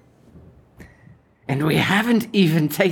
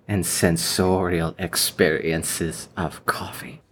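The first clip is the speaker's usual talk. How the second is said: whisper; 8 semitones lower than usual. Acted. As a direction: breathe it out in a whisper. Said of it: "A S M R".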